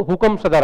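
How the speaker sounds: "हुकम सदर" as an unschooled hearing of English; Hindi-fy it to "हुकम सदर", hu-kam sa-dar; garbled English challenge. Shown as a demonstration. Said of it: "लोग पढ़े लिखे नहीं थे". ("हुकम सदर": देसी बिगड़ा उच्चारण)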